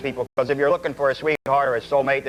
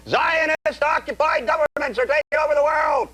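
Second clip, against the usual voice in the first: ranting shout; 10 semitones higher than usual. Retold or imitated. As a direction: yell it like a street ranter. Imitating a ranting speaker.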